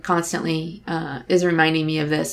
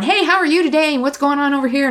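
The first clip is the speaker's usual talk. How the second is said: mock chirpy greeter; 6 semitones higher than usual. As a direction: put on an eager chatterbox greeting strangers. Mock outgoing chatty stranger.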